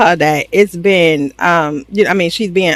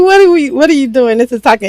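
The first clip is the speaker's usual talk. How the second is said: high-pitched retold complaint; lifted, incredulous mock protest; 6 semitones higher, faster than usual. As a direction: imitate kids objecting to mom, pitched up. Retold children protesting to their mother.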